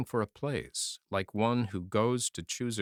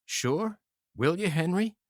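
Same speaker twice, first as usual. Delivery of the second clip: higher, lightly voiced pleading; beseeching; 8 semitones higher, 3 dB louder than usual. Wounded soldier pleading with his friend, lightly beseeching. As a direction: plead for reassurance. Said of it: "the tall soldier beseeched".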